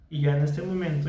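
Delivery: quick autopilot speech. Shown as a demonstration. Therapist showing careless everyday talking, quick and fluent. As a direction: rattle it off without listening to yourself. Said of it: "ya solamente estoy hablando".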